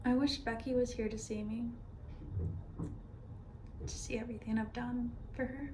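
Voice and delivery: soft, hushed longing; wistful grief. Acted. Wishing her late friend could see her, softly wistful. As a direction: speak softly, missing a lost friend.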